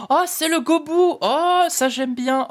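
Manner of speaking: delighted squeal. Real, not acted. Pulls a favourite card.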